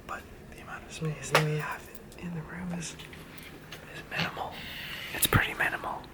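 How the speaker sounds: soft unvoiced whisper. Real, not acted. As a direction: whisper so sleepers don't wake. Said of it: "and I'm whispering".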